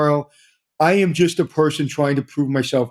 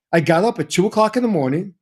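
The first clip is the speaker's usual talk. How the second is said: usual speaking voice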